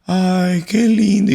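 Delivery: high swooning mock wife voice; husband imitates wife's high delighted sigh; wife touched. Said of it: "ella se sintió como que".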